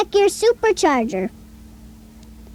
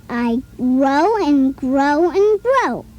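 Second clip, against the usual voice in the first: slow, every "grow" drawn out on its long O; slow teacherly repetition of "grow"; O sound stressed; 3 semitones lower, slower than usual. Shown as a demonstration. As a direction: stretch "grow" slowly so the child hears the O. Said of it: "Which word has the O sound".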